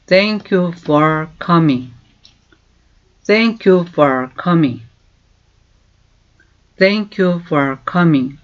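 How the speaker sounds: very slow, clear English for learners to copy; slow model phrase. Shown as a demonstration. Teacher slowly modelling a question. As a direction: stretch each word for repeating. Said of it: "teacher models the phrase".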